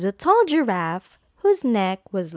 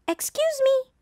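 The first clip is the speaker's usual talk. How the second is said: tiny high cricket voice; cricket's cough. A narrator's reading. Cricket politely interrupting Gerald, tiny high voice. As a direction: squeak it like a small insect. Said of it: "coughed a cricket".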